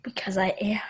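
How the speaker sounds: whispered reply; breathy whisper to his dad. Real, not acted. Son whispering back to his dad. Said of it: "How come you're so quiet?".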